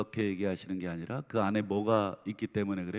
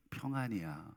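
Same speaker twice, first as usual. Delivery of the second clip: soft tender aside; 4 semitones lower, 11 dB quieter than usual. Acted. God's true heart stated softly.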